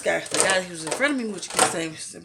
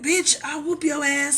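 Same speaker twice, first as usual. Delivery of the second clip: mock threat, higher and drawn out; stretched-out, raised-pitch retold threat; imitated going off; 7 semitones higher, slower than usual. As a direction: imitate a man going off, slow and high. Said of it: "So he's going off".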